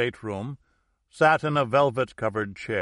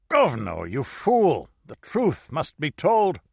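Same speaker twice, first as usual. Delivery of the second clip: put-on higher, louder furious old woman; angry outburst; 5 semitones higher, 4 dB louder, slower than usual. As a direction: snap at the fool priest. Dying woman curses the priest.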